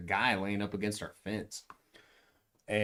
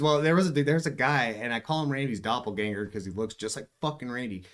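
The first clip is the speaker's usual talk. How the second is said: ordinary talk, nothing marked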